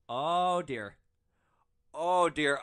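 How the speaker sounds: mock alarmed oh dear. Real, not acted. Shock at Adam's apple.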